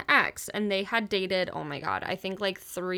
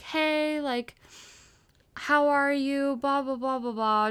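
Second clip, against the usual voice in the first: a lightly voiced sugary ex, pitched up; mock sweet ex; 5 semitones higher than usual. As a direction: imitate the ex playing nice. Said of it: "playing nice".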